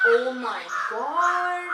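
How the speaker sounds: loud, drawn-out amazement rising at the end; big, slow, thrilled exclamation. Shown as a demonstration. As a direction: stretch it out in loud astonishment. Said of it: "when you have exclamation".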